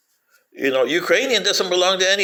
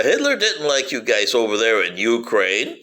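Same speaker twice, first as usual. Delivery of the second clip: ordinary talk, nothing marked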